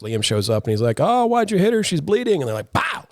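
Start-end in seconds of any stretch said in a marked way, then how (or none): 1.0-2.4 high, whiny protest. Retold or imitated. Liam's retold whiny line. 2.7-3.1 sharp high pow; high pow sound effect. Retold or imitated.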